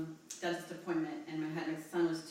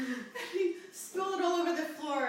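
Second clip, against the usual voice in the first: laughing through the words, pitched up; half-laughing while telling it, louder; 11 semitones higher, 5 dB louder than usual. Real, not acted. Telling a friend about the spilled thumbtacks, laughing.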